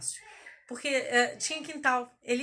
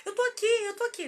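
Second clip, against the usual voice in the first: put-on high, desperate pleading to wake the child; frantic mom pleading mamãe tá aqui; pleading mother; 9 semitones higher than usual.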